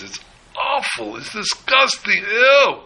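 disgusted sheep. Acted. Sheep smelling the lion's breath, high hammed-up disgust.

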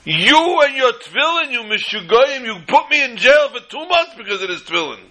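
mock yell of a soldier blaming the rabbi for jail; screaming soldier. Soldier confronts the rabbi nose to nose, mock screaming. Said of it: "And he's screaming".

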